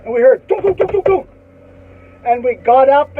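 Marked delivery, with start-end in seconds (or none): 0.5-1.3 rapid high-pitched "tuk-tuk-tuk" noises; high, staccato imitated thuds; imitated knife impacts. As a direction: mimic a volley of knives striking wood. Imitating the knives hitting the desks.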